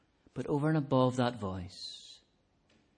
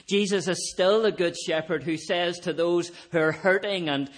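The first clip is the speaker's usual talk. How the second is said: raised, louder impassioned preaching; preaching at full force; 6 semitones higher, 4 dB louder than usual. Acted. Preacher calling out to the hungry and thirsty, impassioned. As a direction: lift the congregation with a ringing appeal.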